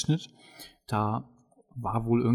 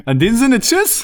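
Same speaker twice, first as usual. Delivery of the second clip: sing-song goodbye; 11 semitones higher, 11 dB louder than usual. Real, not acted. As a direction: swing the goodbye up cheerfully. (hoch gesungener Abschiedsgruß)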